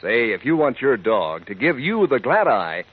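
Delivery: usual speaking voice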